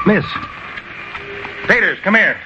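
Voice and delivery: high urgent call. Acted. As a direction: call him over from afar. Calling partner across street.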